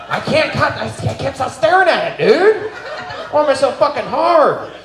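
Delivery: gush, pitch climbing up; excited. Real, not acted. Gushing over a roastee's butt.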